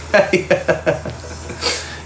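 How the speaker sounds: unvoiced soft chuckle trailing off; breathy laugh. Real, not acted. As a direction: let a quiet laugh fall away. Laughs after stalker story.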